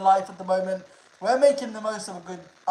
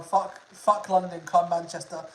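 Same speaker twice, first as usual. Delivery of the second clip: ordinary talk, nothing marked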